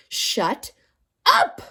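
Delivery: very high, loud scream of shut up; yelled. A narrator's reading. Teacher yelling at the class a third time. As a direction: explode at the class. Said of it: "Missus Jules yelled a third time".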